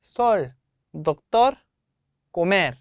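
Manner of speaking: sol, doctor, comer each stressed on the final vowel; stress on last syllable. Shown as a demonstration. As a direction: lift and stress SOL, the TOR of doctor, then the MER of comer. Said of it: "강세를 줘서 발음을 하시면 됩니다".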